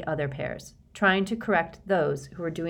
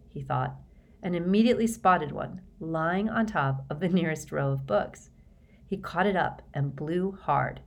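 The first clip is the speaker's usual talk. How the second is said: ordinary delivery, nothing marked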